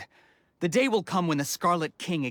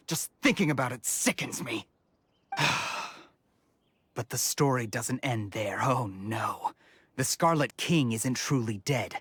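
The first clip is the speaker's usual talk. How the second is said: ordinary delivery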